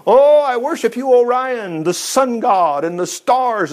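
high, overblown adoration; mock worshipper. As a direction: imitate star-worshippers in a high mock-reverent voice. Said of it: "people going out there going".

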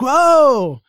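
high-pitched startled whoa; imitating a scared cry-out. Woman on the bus as he walks past, scared cry. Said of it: "kind of like a scared".

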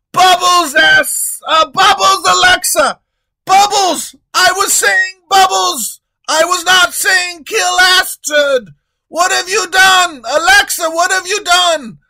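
very high, loud panicked shout; very high, loud king yelling at Alexa. Acted. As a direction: scream at the bodyguard in horror. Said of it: "The king continues yelling".

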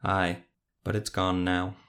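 ordinary delivery

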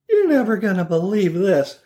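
high, put-on old-lady voice; old grandma voice. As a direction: confide it like a scatty old grandma.

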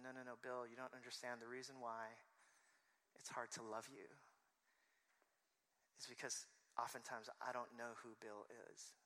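soft, measured reply with long pauses; hushed, serious correction; quiet explanation. Retold or imitated. Acts wife's quiet explanation.

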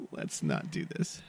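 low unvoiced whisper; whisper, pleading; whispered plea. Pleading under breath.

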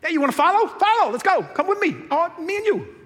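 breezy invitation to follow; lightly voiced cheerful Jesus; Sunday school Jesus. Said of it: "a Jesus who's all about".

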